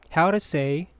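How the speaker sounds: ordinary talk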